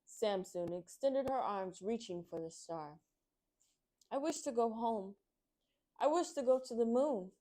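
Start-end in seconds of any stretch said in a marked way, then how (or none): 4.1-7.3 crying out. Acted. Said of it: "Samson cried out".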